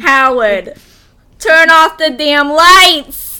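hammed-up Brooklyn mom shouting at her stepson; weird Brooklyn accent. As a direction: bark at Howard in a Brooklyn accent. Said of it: "that weird Brooklyn accent".

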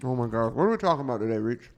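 talking with a mouth full. Real, not acted. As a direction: talk round a mouthful of food. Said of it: "I'm gonna talk to you in my mouth full".

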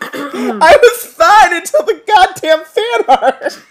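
slow, teary words broken by laughter; real tears and laughter at once; laughing through tears. Real, not acted. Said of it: "You're in literal tears".

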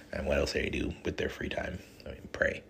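a hushed, breathy aside to the audience; whispered aside. Real, not acted. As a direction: let it slip out in a whisper. Whispered aside about priests.

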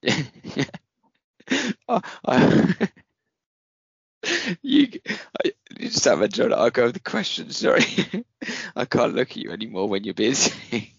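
high breathless giggling words; struggling through laughter; laughing while talking. Real, not acted. Still laughing helplessly.

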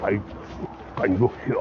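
put-on low, half-hearted Russian accent; failed Russian accent. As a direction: attempt a Russian accent and give up. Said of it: "I can't do a Russian accent today".

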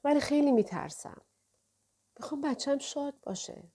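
afraid, barely above a murmur; trembling murmur. A narrator's reading. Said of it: "با صدای لرزان زیر لب گفت".

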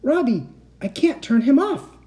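high, panicked cry; high-pitched alarmed wail; panicked call. A narrator's reading. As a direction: call out helplessly for a friend. Moaning plea as switch sticks.

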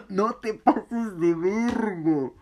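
slow, high, crying with laughter; laughing so hard the words stretch out; laughing plea. Retold or imitated. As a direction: choke the words out through laughter. Laughing at friend's joke.

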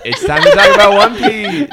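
excited announcement. Real, not acted. Announcing the topic, laughing.

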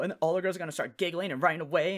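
normal talking voice

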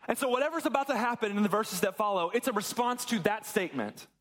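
ordinary delivery

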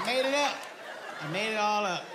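ordinary delivery

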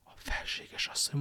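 hushed, voiceless echo of the words; stunned whisper. A narrator's reading. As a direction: whisper it as if about to faint. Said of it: "és úgy elsápadt".

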